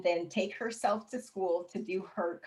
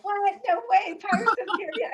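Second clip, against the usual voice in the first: imitating the students, higher and louder; excited students; 14 semitones higher, 9 dB louder than usual. Students thrilled she's auditioning for Pirates, excited and higher. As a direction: act out the kids' thrilled disbelief.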